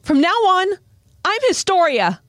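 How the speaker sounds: high, called-out proclamation; acted high, emphatic announcement; bold declaration. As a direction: declare it proudly across a distance. Krista declares new name to Connie.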